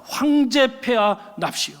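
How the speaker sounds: acted ceremonial call; loud herald's cry. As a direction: announce the emperor like a court herald. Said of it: "바깥에서 크게 외치는 소리가 있었습니다".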